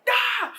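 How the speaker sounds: short, very high startled scream; mock scream. Players startled in VR horror game, mock screaming. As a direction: yelp high as if jump-scared.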